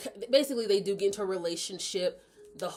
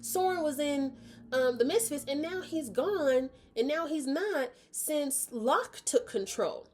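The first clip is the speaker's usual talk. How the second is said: higher townsperson voice; 9 semitones higher than usual. Retold or imitated. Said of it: "I'm gonna say his name".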